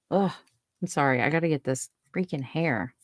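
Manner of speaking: ordinary delivery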